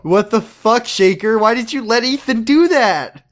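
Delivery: high-pitched, incredulous scolding; comic disbelief, pitch climbing; exasperated outcry. Real, not acted. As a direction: build to a high, appalled question. Exasperated outcry at absent character.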